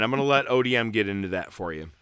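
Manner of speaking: normal talking voice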